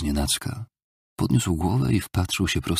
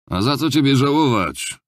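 raised, higher-pitched challenge; innkeeper's scornful shout; 9 semitones higher, 5 dB louder than usual. A narrator's reading. Innkeeper challenges the pitiful drunkard, shouting in scorn. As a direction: call it out scornfully. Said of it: "krzyknął gospodarz".

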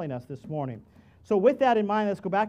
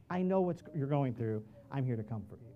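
softer, lower and gentle; God quietly comforting the struggling; tender reassurance; 5 semitones lower, 6 dB quieter than usual. Acted. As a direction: hush it down tenderly. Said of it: "God is looking down from heaven saying".

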